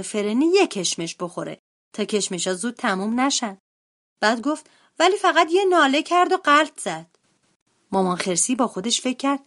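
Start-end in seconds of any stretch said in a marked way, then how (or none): 5.0-7.1 put-on high-pitched little boy bear voice; brother bear at breakfast. A narrator's reading. Brother telling mother about sister over breakfast, high child voice.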